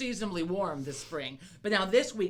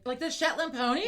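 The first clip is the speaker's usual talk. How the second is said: high, louder incredulous question; mock question; 8 semitones higher, 5 dB louder than usual. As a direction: pitch it up in mock disbelief. Mocking idea of pony.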